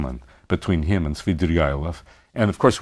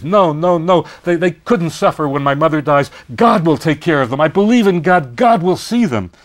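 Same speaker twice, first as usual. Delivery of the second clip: higher, louder insistent plea; Sonya pleading; 8 semitones higher, 5 dB louder than usual. Retold or imitated. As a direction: protest fervently like a devout young woman. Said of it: "Sonya says".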